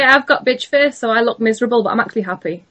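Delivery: normal talking voice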